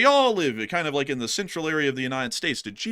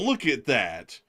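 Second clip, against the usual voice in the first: usual speaking voice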